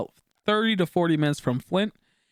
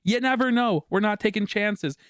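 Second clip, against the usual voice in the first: higher, pressing warning to mom; urgent warning to mom; 7 semitones higher than usual. Retold or imitated. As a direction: urge her to stay in the car. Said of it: "I'm like".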